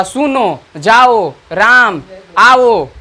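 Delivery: सुनो, जाओ, राम, आओ each with long vowels; सुनो, जाओ, राम, आओ as separate, lengthened calls; calling, drawn out. Shown as a demonstration. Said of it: "जहाँ भी आपका पुकारना होगा".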